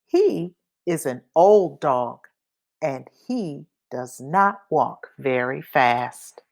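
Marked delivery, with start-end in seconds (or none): none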